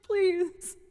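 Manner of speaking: high, loud begging please; high pleading. Retold or imitated. Begging the lead singer to stop, high pleading. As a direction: beg like a kid for a treat.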